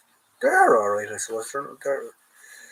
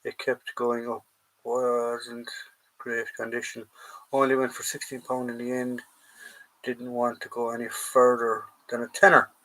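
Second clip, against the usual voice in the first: ordinary talk, nothing marked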